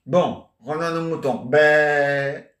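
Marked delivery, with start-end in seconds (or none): 1.5-2.5 long, loud mock bleat; sheep bleat. Punning on returning to one's sheep, a long mock bleat. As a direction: draw it out like a sheep's baa. Bleat after "revenons à nos moutons.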